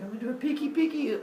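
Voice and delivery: high, silly peekaboo voice; Father Sky. Acted. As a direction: tiptoe into it in a high, playful voice. Father Sky nervously peeks.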